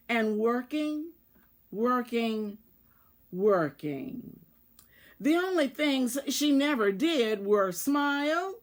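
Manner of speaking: storybook narration. Acted. Teacher reading a picture book to her class, expressive storytelling. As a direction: tell it like a bedtime story to children.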